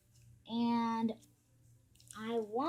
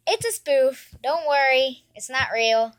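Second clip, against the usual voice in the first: louder, insistent repeat; loud reassurance; 11 dB louder than usual. Real, not acted. Kid insisting to viewers it was fake, loudly. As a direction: shout the reveal so everyone hears.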